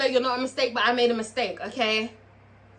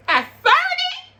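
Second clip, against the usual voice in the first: a much higher, laughing, crying-out "at thirty?"; shocked squeal; 5 dB louder, slower than usual. Real, not acted. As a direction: squeal it in disbelief, half-laughing.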